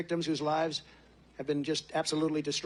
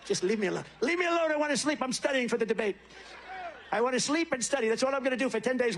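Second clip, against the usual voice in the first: mocking Biden; 6 semitones higher than usual. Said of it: "He wants a place to sleep".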